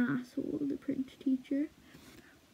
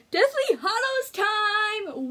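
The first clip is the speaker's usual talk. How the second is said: slow, higher, louder announcer call; grand announcement; 10 semitones higher, 7 dB louder, much slower than usual. Acted. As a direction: proclaim it like a showman. Announcing next tattoo.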